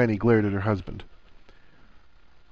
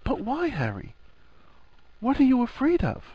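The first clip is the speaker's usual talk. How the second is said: higher mock-offended woman's voice; female voice, huffy; 14 semitones higher than usual. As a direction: huff it out, teasing. Hermione voiced.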